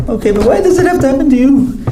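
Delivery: high, pleading mother's voice with a laugh; mother's pleading question. Retold or imitated. Son retelling his mother asking why him, high and pleading. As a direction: plead like a worried mom. Mother asks again, much higher.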